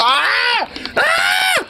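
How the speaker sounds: very high-pitched squealing scream. Real, not acted. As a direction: squeal in horrified reaction. Screams at sight of syringe.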